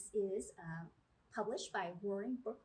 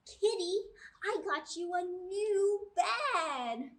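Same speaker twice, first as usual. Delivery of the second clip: much higher and louder, slow and thrilled; excited little girl; 8 semitones higher, 9 dB louder, slower than usual. Acted. Chloe proudly shows Kitty her new bed, high and thrilled. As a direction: squeal it like a delighted child.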